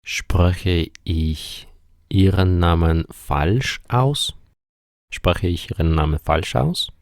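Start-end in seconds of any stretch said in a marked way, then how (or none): 0.0-4.3 slow, word-by-word German question; measured teaching pace, drawn-out words; slow model sentence. Shown as a demonstration. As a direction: say it slowly for learners, pausing after "ich". Teacher models question slowly, then repeats quicker.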